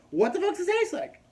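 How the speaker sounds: high-pitched, loud mock-incredulous yell; flipped-out nurse. Nurse asking about the taste of spinal fluid, flipped out. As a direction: imitate an outraged nurse demanding an answer. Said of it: "flips out".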